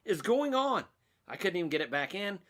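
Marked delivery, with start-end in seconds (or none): 0.0-0.8 high, stunned what-the-heck; mock bewilderment. Stuck with a dismantled bridge, high mock exasperation. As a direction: pitch it up like you've lost the plot. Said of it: "and I'm like".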